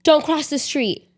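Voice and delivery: high, urgent parental command; sharp warning to stop a child; very serious warning. Shown as a demonstration. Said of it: "very serious".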